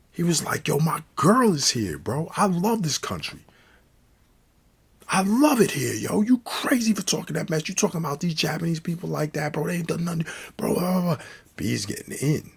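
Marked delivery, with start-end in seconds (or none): 0.7-11.3 high, worked-up tirade speeding up; imitating a friend going off; indignant rant. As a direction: rant in outrage, trailing into blah-blah. B's indignant rant, retold.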